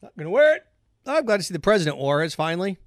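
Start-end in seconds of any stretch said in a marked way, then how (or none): none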